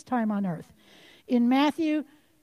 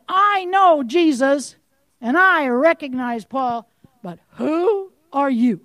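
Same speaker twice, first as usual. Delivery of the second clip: slow, louder menacing spirit voice; evil spirit; 5 dB louder, slower than usual. Acted. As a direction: sneer it out slowly as the demon. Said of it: "The evil spirit answered them".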